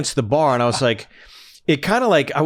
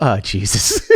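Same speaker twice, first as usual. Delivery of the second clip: laughing; 3 dB louder, slower than usual. Real, not acted. Laughing at own story.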